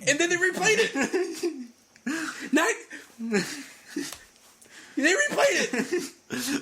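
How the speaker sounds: high-pitched, incredulous outburst; comic exasperation breaking out; exasperated anger. Real, not acted. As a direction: protest it in high-pitched disbelief. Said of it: "it made me angry".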